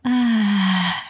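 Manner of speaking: long, slow sigh of relief; relaxed sigh. Shown as a demonstration. To listeners, describing a massage, a long relieved sigh. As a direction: melt into the sigh like on a massage table.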